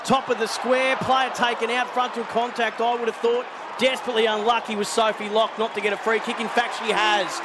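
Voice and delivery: live play-by-play. Acted. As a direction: call the action as it happens. Live football commentary.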